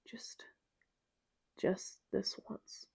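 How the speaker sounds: breathless, barely voiced; weak whisper of a dying man; slow fading whisper; faint dying whisper. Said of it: "His gray eyes blink blearily at me".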